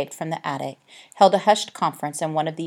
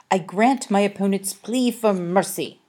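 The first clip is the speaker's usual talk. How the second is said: magnanimous; 4 semitones higher, 4 dB louder than usual. A narrator's reading. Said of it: "said Harvey magnanimously".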